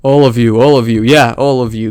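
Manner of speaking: loud imitation of a man yelling; mock screaming. Commentator imitating the man screaming at random workers, loud mock yell. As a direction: belt it out at everyone, mimicking his rant. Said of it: "This guy is screaming".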